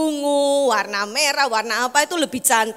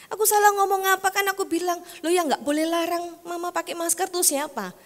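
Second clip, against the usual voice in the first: lightly raised pitch, defensive; higher defensive son; 6 semitones higher, 4 dB quieter than usual. Retold or imitated. Son defending himself to his mother, higher. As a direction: defend yourself a little higher.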